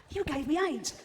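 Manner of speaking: high-pitched, squealed accusation; mock outraged accusation. First patient confronts the chimp in the jungle, mock outraged.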